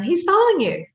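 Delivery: high, amazed exclamation as friends; retold high-pitched amazement; imitating amused friends. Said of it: "My friends are laughing".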